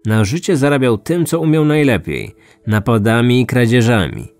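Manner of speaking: usual speaking voice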